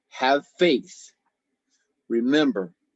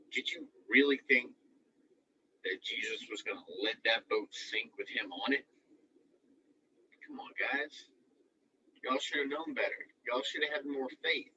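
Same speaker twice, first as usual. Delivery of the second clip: much softer, confiding; hushed aside; 11 dB quieter than usual. Real, not acted. Coach tells the kids they should have known better, softly. Preacher drops to near-hush aside.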